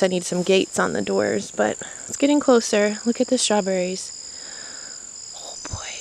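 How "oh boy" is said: soft dismayed whisper. Real, not acted. Whispered at sad strawberries.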